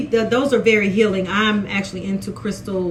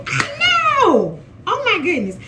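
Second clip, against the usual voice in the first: excited; 9 semitones higher, slower than usual. Real, not acted. Reacting to the quote.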